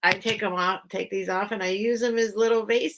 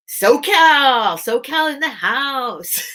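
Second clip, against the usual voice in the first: loud, high, stretched-out whoop; big drawn-out party cheer; cheering shout-out; 6 semitones higher, 8 dB louder, much slower than usual. Real, not acted. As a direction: hype up the new arrival. Cheering an arriving viewer.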